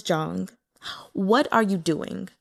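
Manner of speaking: ordinary delivery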